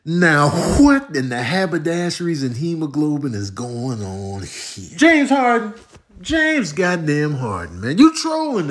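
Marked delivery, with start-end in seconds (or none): none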